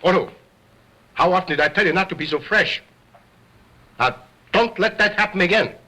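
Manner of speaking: sharp, fast scolding. Acted. Ventriloquist scolds Otto for being fresh, sharp scolding. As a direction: tell off the naughty dummy.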